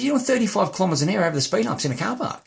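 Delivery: high-pitched retold scolding; incredulous scolding. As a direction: re-enact telling off a reckless driver. Retold scolding of careless driver.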